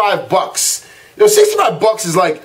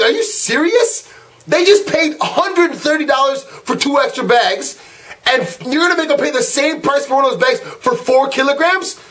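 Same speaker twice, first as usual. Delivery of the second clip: indignant disbelief; 4 semitones higher than usual. Retold or imitated. Man protesting an extra bag fee to the airline agent, indignant. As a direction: retell an incredulous protest, pitch raised.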